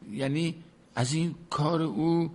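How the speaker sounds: ordinary talk, nothing marked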